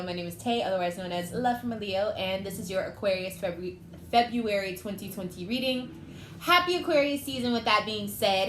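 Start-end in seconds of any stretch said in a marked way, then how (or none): 6.4-7.5 bright, upbeat birthday-season cheer, higher and louder; excited greeting. Real, not acted. Tarot reader cheering Aquarius viewers in an excited, higher, louder greeting. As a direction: throw a happy cheer out to the viewers.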